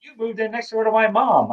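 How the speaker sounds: high, teasing imitation; mock friend's line. Teasing imitation of his friend meeting Terry. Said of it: "You go like".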